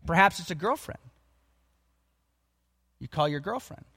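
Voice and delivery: normal talking voice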